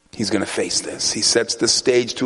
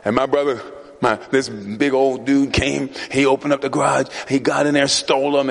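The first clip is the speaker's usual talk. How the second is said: usual speaking voice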